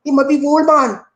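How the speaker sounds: high, lively Gullah Geechee dialect. Shown as a demonstration. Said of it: "We'll say".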